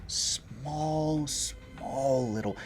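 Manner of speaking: slow, soft drawn-out description; slow wonder. Acted. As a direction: linger on the tiny details. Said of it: "GM slows describing tiny flowers".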